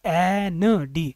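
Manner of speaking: एनडी stretched very slowly from a clear e; very slow demo word. Shown as a demonstration. Teacher sounding out an abbreviation. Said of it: "ए से start होता है".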